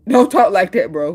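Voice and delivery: laughing it off loudly. Real, not acted. Telling a friend not to talk like that, laughing loudly. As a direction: say it through a laugh.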